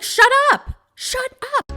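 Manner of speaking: high-pitched mock-angry squeal; exasperated outburst. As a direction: burst out high, then drop the volume. Fed up with her side commentary.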